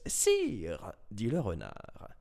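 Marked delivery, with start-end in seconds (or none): 0.1-0.9 lightly voiced, higher fox courtier; flattering fox. Fox courtier addressing the lion king, flattering.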